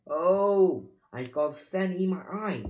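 high whine with a loud opening moan; whining. A narrator's reading. Pirate complaining about sand in his eye, whining. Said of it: "Pinky whined".

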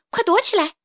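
high-pitched alarmed call; urgent warning. Acted. Youngster warning friends of the racing carriage, urgent high call.

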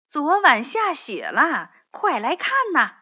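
high, loud eager call; bursting to show the snow; eager shout to his friend; excited. A narrator's reading.

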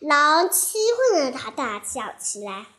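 ordinary delivery

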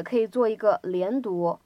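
normal talking voice

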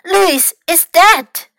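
high, loud, slow exclamation; alarmed cry. A narrator's reading. Fox believes Louis has died, alarmed cry. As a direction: cry out the dreadful news. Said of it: "cried Fox".

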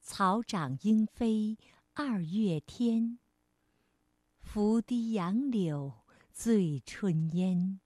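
slow, gentle classical verse; soft poem recitation. Shown as a demonstration. Teacher reads the old poem to children, slow soft recitation. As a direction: recite softly, lingering on each line ending. Repeats the poem more softly.

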